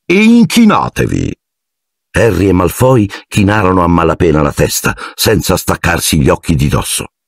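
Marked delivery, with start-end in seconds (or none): 0.0-1.3 high, showy call to bow; theatrical command. A narrator's reading. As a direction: announce it like a stage host. Lockhart directs duel. (comando teatrale e acuto)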